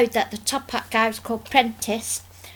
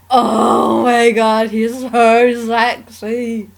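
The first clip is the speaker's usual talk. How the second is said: slow, louder drawn-out swoon; breathless swoon; 8 dB louder, much slower than usual. Retold or imitated. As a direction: linger on every word, starstruck. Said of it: "It's like".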